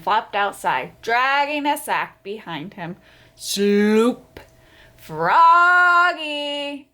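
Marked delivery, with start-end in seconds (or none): 3.3-4.4 dragging sound. A narrator's reading. 5.0-6.9 loud, drawn-out call from far away. Acted. Mother calling Froggy back from the door, loud. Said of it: "called his mother".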